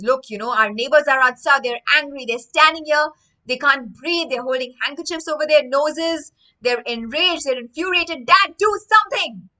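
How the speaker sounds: put-on a boy begging his father for help; urgent plea to dad. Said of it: "wakes his father up and says".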